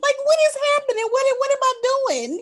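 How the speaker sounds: very high, loud incredulous rant; hammed-up squealing protest with a laugh inside; mock diatribe. Host voices the son protesting to his mother, mock distress. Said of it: "went into this whole diatribe".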